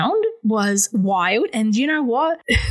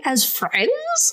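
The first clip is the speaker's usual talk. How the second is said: incredulous question; 7 semitones higher than usual. Retold or imitated. Acting out incredulous reply to ex.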